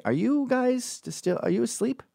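lilting chaperone nudge; teasing teacher. Retold or imitated. Teacher at a school dance checking on kids, high and gentle. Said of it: "a teacher would come over and say".

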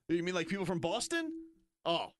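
ordinary talk

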